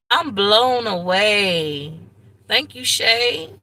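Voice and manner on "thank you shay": ordinary talk, nothing marked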